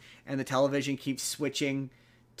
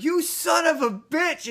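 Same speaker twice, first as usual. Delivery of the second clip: much higher, louder and slow; mock furious yell; 7 dB louder, slower than usual. Man cursing the priest who tricked him, furious. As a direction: curse him out in hammed-up rage.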